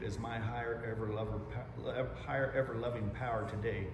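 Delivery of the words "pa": ordinary talk, nothing marked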